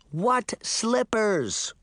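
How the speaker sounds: put-on slow, drawn-out girl's demand; shouted. A narrator's reading. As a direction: cut through their bickering. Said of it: "Sabrina shouted".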